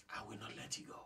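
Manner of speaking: a soft, tender whisper; whispered promise. Acted. Grace whispering to a despairing listener, tender whisper. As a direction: breathe it like a secret promise.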